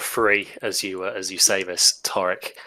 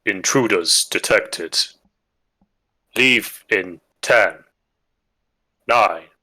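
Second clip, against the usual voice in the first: booming arrogant alien overlord; 4 dB louder, slower than usual. Acted. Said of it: "a kind of booming voice".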